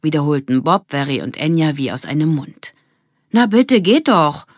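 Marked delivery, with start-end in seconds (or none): 3.3-4.4 put-on high, loud and exasperated, a girl giving in; higher, louder character voice, exasperated; impatient girl. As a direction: toss it off like a fed-up girl finally giving way. Character Lilly relents impatiently.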